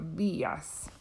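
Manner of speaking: "the, us" with the emphasis up front; stress on first syllable. Shown as a demonstration. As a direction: lean on "the" and let "us" fall away soft. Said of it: "it gets the emphasis".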